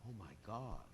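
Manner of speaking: open-mouthed, awestruck gasp of words; amazed. Shown as a demonstration. Speaker shows devotees open-mouthed wonder at hearing pastimes, amazed. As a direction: act out being struck with amazement. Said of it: "if you're really amazed at something".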